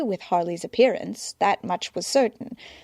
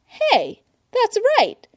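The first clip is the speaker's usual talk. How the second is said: higher, excited dawning; sudden realization; 5 dB louder, slower than usual. A narrator's reading. Dick realizing, telling Barbara, excited. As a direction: it just clicked for him. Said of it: "as realization hit".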